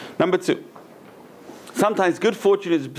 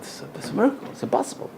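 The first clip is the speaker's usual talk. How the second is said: breathless, high, amazed hush; awed gasp; 12 semitones higher, 4 dB quieter, much faster than usual. Retold or imitated. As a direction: gasp it out like you can't believe your eyes. Servant marvels at gold dishes.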